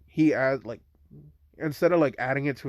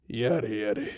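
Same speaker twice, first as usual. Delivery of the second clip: sighing; 3 semitones lower, 4 dB quieter than usual. Retold or imitated. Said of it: "He just sighs and says".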